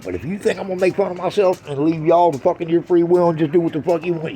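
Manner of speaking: ordinary talk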